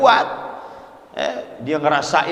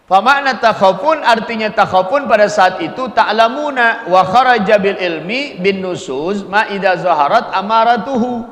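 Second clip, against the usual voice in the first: loud, raised Arabic recitation; reciting kitab text; 4 semitones higher, 4 dB louder than usual. Acted. Preacher reads the Arabic source to the congregation, intoning loudly. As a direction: intone the classical text at full voice.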